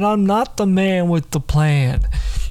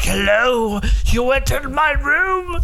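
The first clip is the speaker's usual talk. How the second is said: higher, slow eerie voice; creepy greeting; 7 semitones higher, slower than usual. Retold or imitated. Sleepless man greets someone in his room, eerie. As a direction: greet the intruder like a haunted doll. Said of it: "I just have my eyes open like".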